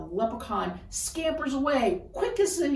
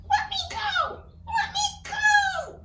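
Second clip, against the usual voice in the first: very high, pleading little leprechaun; 4 dB louder than usual. Acted. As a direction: squeal to be let go, like a trapped leprechaun. Leprechaun struggling to escape.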